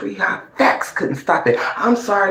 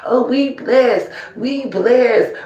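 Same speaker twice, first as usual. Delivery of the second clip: a light mocking imitation of rivals; hint of a mocking rival voice; mocking their catchphrase; 6 semitones higher, slower than usual. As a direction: parody their smug blessed line. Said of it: "that term y'all use so much".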